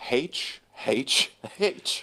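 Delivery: "H" pronounced "haitch", each one separate; aspirated H. Retold or imitated. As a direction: sound the H at the front: "haitch". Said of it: "acronym is".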